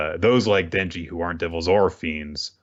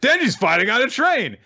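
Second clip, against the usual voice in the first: much higher, louder yell; high excited shout; excited Power; 5 dB louder than usual. Retold or imitated. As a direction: blurt it out thrilled. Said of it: "Power's like".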